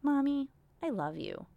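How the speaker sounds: put-on imagined child's sweet voice, lightly done. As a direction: say it like an adoring little kid. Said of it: "But it's not like".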